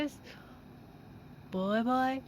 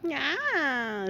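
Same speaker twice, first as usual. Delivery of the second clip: ordinary talk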